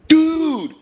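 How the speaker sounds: high, drawn-out mock stoner voice; mocking stoner buddy. Buddy teasing him for being high, drawn-out high voice. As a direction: stretch it out like a baked buddy. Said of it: "loud as fuck, bro".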